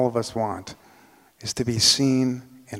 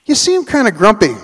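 higher and louder, calling across the noise; louder, lifted question to a grumpy customer; raised voice; 8 semitones higher, 6 dB louder than usual. Retold or imitated. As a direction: speak up over the machines. Calling over buzzing copy machines.

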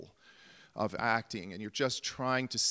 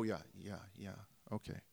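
ordinary delivery

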